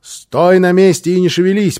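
urgent warning from a distance; commanding. A narrator's reading. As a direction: order someone firmly to freeze. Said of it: "приказал он малышу".

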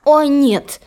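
high, spoiled, annoyed sister; vexed sister. Acted. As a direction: whine it in vexation. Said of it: "досадовала Дризелла".

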